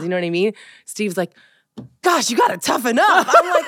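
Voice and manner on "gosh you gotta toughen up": higher-pitched mock scolding; mock exasperation. Parent imitates partner scolding their son, mock exasperation. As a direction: imitate an impatient dad, lightly exaggerated. Impatient father quoted at the child.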